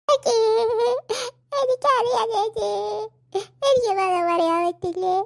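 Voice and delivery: sobbed words, drawn out; crying. Acted. Crying girl telling her sister she can't say it, sobbing. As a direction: wail it to big sister between sobs. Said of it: "എന്തിനാ മക്കള് കരയണേ".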